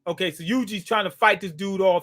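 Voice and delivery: ordinary talk, nothing marked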